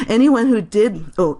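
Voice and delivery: ordinary delivery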